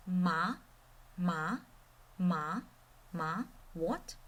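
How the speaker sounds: MA and WHAT rising from low; second tone. Shown as a demonstration. Teacher drills tone two, rising. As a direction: lift every "ma" and "what" like a question. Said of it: "So the second tone is".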